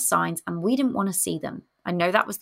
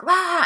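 mock angry roar; 10 semitones higher, 4 dB louder than usual. Defensive partner lashing out.

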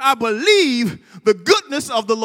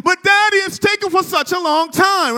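put-on whiny child; 7 semitones higher, 3 dB louder than usual. Son begging his daddy for the T-Rex now, whiny. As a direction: whine it like an impatient little boy. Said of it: "him saying".

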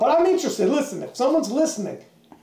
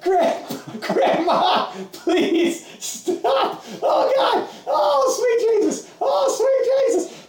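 much higher, slow, shouted, laughing cries; hammed-up agonized scream; 13 semitones higher, much slower than usual. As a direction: wail in mock agony as the wheel spins. Wheel tearing flesh, screaming.

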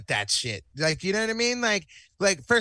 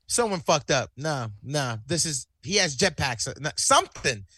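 normal talking voice

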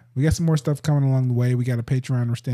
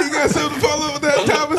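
laughing; 13 semitones higher, faster than usual. Real, not acted. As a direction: talk fast through laughter. Teasing cohost amid laughter.

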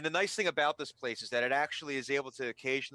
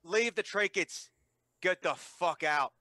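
slow, firm, threatening; menacing order; 5 semitones higher, 4 dB louder, slower than usual. Acted. Guard slowly ordering the cleric to leave, menacing. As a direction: lay down the law.

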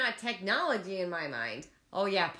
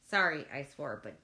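ordinary talk, nothing marked

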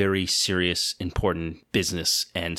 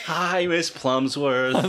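exaggerated greeting; 5 semitones higher, much slower than usual. Playful greeting at the door.

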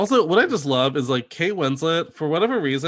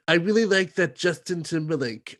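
slightly raised, put-on filmmaker voice; Woody Allen; 4 semitones higher than usual. Imagining the director choosing a cast, light impression. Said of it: "in your Woody Allen voice".

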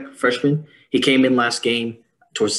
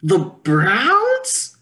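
incredulous; 11 semitones higher, 4 dB louder, much slower than usual. Real, not acted. Incredulous repeat of team name.

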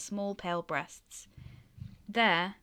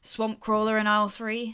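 curt; 4 semitones higher, 8 dB louder than usual. Acted. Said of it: "she said shortly".

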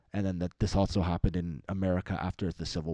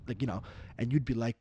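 ordinary talk

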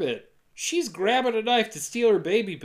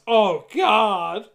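loud, hammed-up cry of despair; imitating a distraught mother's shout; retold shout; 6 dB louder than usual. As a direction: cry out in mock anguish. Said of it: "Then Pharaoh shouts".

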